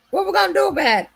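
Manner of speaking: squeaky mock panic. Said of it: "that was screaming out".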